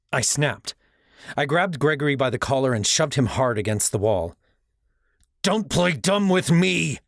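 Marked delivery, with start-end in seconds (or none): 5.4-7.0 slow, furious growl. Acted. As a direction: growl it slowly with fury. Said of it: "I growled".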